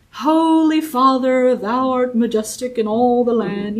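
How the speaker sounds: lightly mocking a lofty old-fashioned prayer; formal prayer voice. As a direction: put on a stiff churchy prayer voice. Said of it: "some people do talk like that".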